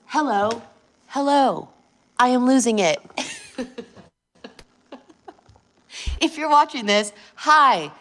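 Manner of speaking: high, loud mock hello to the ex-wife; loud taunting greeting. Real, not acted. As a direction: call out to her, taunting. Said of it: "she's probably watching this".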